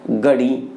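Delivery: गड़ी with plain g and low pitch; Haryanvi गड़ी. Shown as a demonstration. Teacher repeating the Haryana form, गड़ी low. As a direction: drop the breath, say गड़ी low. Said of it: "Haryana में जब उच्चारण होता है".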